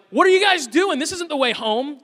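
mock child voice, high and loud; kids asking. Kids in back seat questioning dad's route, high and loud. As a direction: play the confused kids in the back.

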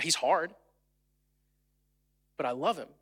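ordinary delivery

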